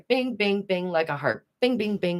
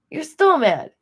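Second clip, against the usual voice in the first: "still mad" exclaimed louder and higher; 8 semitones higher, 6 dB louder, slower than usual. Acted. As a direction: throw "You're still mad" out in disbelief. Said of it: "repeats the line, now exclaimed".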